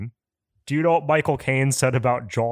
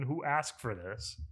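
much softer, resigned repeat; 13 dB quieter than usual. Real, not acted. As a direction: say it again under your breath. Repeats complaint, trailing off.

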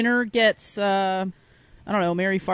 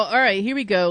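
usual speaking voice

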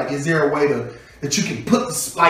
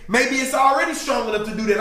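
normal talking voice

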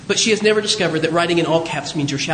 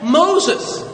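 raised-pitch call of a name; 6 semitones higher than usual. Acted. God answering Moses with a call, raised-pitch summons. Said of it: "It's also a call".

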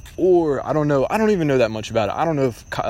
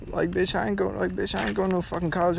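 defiant; 7 semitones higher, 5 dB quieter than usual. Retold or imitated. Defiant reply to judgers.

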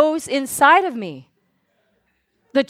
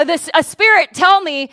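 ordinary talk, nothing marked

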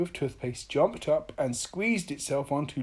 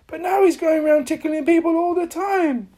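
high, loud outburst; exasperated complaint; 14 semitones higher, 10 dB louder than usual. A narrator's reading. Mister Happy complains about Mister Tickle, high exasperated outburst. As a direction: let the complaint burst out high.

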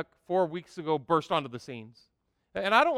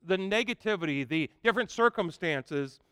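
ordinary talk

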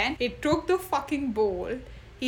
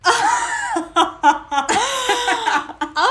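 loud, high, real laugh; burst of laughter; 13 semitones higher, 10 dB louder than usual. Real, not acted. Guest asked if she dates Germans, bursting out laughing.

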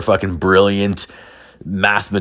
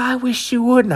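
high-pitched mock girl voice; imitated girl. Retelling the girl's reply.

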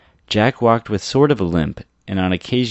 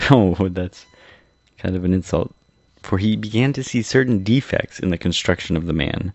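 ordinary delivery, nothing marked

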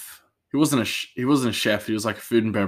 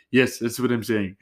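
bad Indian accent. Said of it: "horrible Indian accent".

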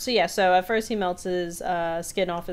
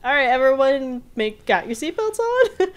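higher, louder upbeat call; cheery driver; 7 semitones higher, 6 dB louder than usual. Retold or imitated. Zeus cheerfully asking everyone to buckle up. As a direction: call out brightly to the passengers. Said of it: "he's like".

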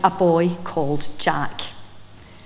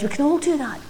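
ordinary talk, nothing marked

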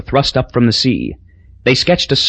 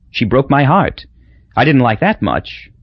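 plain reading voice

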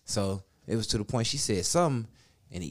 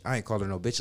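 ordinary delivery

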